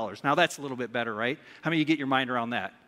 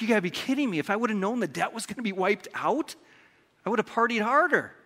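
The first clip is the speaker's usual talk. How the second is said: higher-pitched, hammed-up incredulity; mock disbelief; 6 semitones higher than usual. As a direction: play a debtor who can't believe it. Acting out incredulous debtor's reaction.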